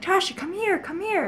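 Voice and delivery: high, sing-song coaxing; luring sister. Retold or imitated. Older sisters luring little sister to the scary noise, high coaxing. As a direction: lure the little one over sweetly.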